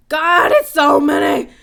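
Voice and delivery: loud, drawn-out cry of dismay; overwhelmed shout. Real, not acted. Streamer facing a flood of comments, overwhelmed shout. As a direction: shout it out, stretching the words.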